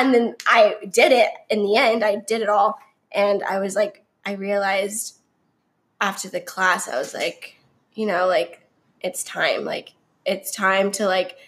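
normal talking voice

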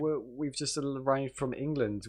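high, slow, dopey settler voice; mock naive settlers. Mocking colonists naming places, high slow dopey voice. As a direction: play a clueless colonist. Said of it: "Look, you got".

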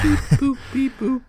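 high squeaky robot beeps; toy robot voice. Retold or imitated. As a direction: beep boop like a toy robot. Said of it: "Patrick goes".

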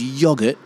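YOGURT as "YOG-urt"; short O in yogurt; double-G pronunciation. Shown as a demonstration. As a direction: yogurt as if spelt yoggurt. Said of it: "it would be double G".

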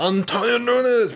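long, high, stretched-out shout of the name; apresentador anunciando. Retold or imitated. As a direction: announce him like a TV host calling a name. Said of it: "ele chegava". (grito alto e arrastado de apresentador)

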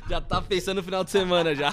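talking through loud laughter; laughing. Real, not acted. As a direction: tease him while still laughing. Teasing while laughing.

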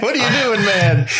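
high incredulous mock voice; a hammed-up high what are you doing. Mocking movie character's behaviour.

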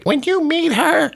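Beth's voice. Retold or imitated. Husband doing friend Beth's voice for the hosts. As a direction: pipe it up high like the excited friend. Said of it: "I don't know why I'm doing this voice".

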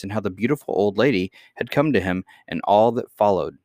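ordinary delivery, nothing marked

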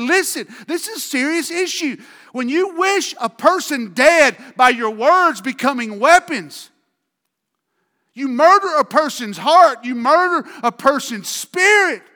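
high, loud preaching; full-force preaching. Acted. Preacher thundering Jesus' warning to the congregation. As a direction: drive it home like a pulpit climax.

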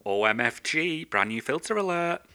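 lightly voiced social media addict squealing; mock excited influencer. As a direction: ham up the breathless influencer. Mocking selfie posters.

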